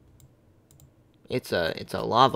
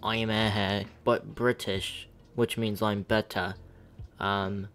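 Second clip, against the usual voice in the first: a streamer's mock British accent, lightly done; playful fake British accent for the chat; put-on British accent; 7 dB louder than usual. Gamer greeting his stream chat in a mock British accent. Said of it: "stop talking like a British ac-, in a British accent".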